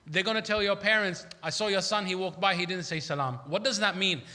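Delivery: ordinary talk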